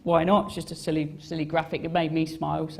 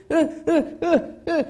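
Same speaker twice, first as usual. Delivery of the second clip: pointing grunts; 11 semitones higher, 5 dB louder than usual. Retold or imitated. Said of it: "just went".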